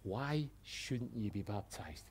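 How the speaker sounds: soft loving question; lovingly. Acted. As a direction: ask it gently, almost tenderly. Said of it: "I'm gonna say it lovingly".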